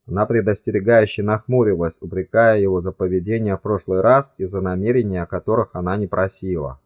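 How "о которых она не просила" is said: usual speaking voice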